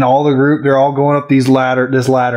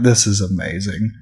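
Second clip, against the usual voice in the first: sarcastic deadpan; 6 semitones lower, 5 dB quieter than usual. Retold or imitated. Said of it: "I was like".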